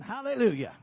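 shouted hallelujah. Retold or imitated. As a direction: belt it out like jumping up on the desk. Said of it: "and shouting".

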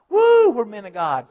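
loud whooping crowd chant; mock crowd cheer. Preacher voicing a stadium crowd cheering, whooping loudly.

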